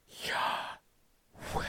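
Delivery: long whispered breath, "yah... weh"; slow voiceless inhale and exhale; breathed name. Shown as a demonstration. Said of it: "to breathe God's name".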